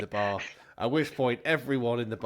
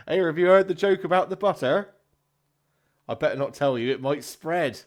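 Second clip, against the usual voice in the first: loud, higher-pitched stand-up delivery; hammy pub comedian; 6 semitones higher, 8 dB louder than usual. Acted. Comedian trying a butter joke on the pub, hammy. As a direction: sell the gag to the room, loudly.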